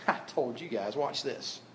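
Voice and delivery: very soft, low boast; smug aside. Acted. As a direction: toss it off under your breath. Mock boast of someone showing off.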